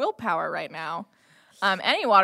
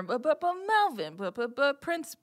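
higher, mumbled crowd babble with names popping out; burbling imitation of overheard chatter; crowd fanfare; 6 semitones higher than usual. Game master imitating the fanfare heard in the street, babbling crowd. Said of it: "is what you're hearing over the sort of, uh, fanfare".

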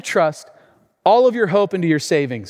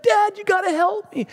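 high-pitched cry for dad's help; pleading young son. Retold or imitated. Losing son begs for help.

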